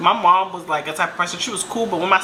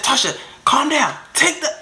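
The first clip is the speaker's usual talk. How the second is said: imitated urgent pleading; 7 semitones higher than usual. Everybody pleading, acted out.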